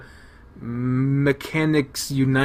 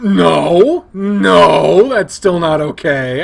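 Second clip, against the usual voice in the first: firm no; 5 semitones higher, 11 dB louder, much slower than usual. Real, not acted. Player scolding Leo's excuse.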